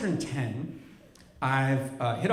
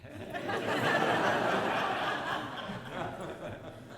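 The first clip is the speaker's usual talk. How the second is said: high giggle; 14 semitones higher, 5 dB quieter than usual. Real, not acted. Laugh after mother's line.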